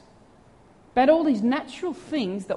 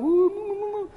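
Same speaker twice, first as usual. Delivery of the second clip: mock wince; 7 semitones higher than usual. Real, not acted. Reacting to wife's outburst.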